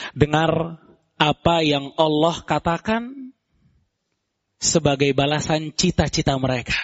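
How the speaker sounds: ordinary talk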